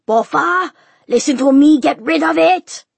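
very loud, high, insistent order; furious, high shouted command; father yelling. A narrator's reading. Father yells at daughter amid uproar.

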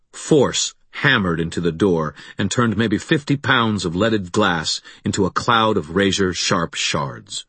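ordinary delivery, nothing marked

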